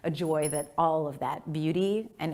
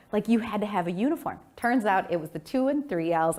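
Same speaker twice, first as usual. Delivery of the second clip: ordinary delivery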